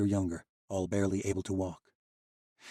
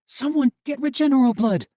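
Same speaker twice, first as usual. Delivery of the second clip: very high, loud panicked call; a girl calling for help over a dying friend; frantic shout; 11 dB louder than usual. Acted. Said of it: "breaths caught and turned frantic".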